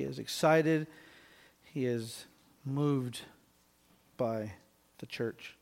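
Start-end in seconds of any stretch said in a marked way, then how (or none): 1.6-5.6 hushed. Acted. Preacher softening to moved awe.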